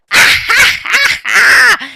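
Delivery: high villain cackle; evil laugh. Acted. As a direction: cackle like a gleeful killer. Killer gloats after a kill.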